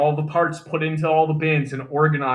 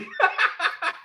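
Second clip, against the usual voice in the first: laughing. Real, not acted. The armorer laughs at his own retort to his squad leader, high unvoiced laughter.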